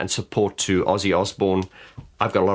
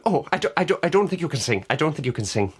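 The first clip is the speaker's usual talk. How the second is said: high, lilting fake Irish accent; bad Irish accent; 7 semitones higher, faster than usual. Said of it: "That's my bad Irish accent".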